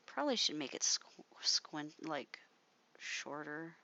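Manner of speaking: soft whisper, thinking aloud; whispered to self. Real, not acted. Artist fixing a drawing, whispering to herself. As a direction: whisper it under your breath while working.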